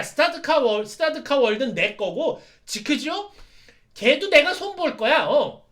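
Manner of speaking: loud, high, heated protest; angry denial. Acted. Satan Gundam denying he serves Zeek Zeon, loud heated protest. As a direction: shout him down furiously. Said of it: "화를 내면서".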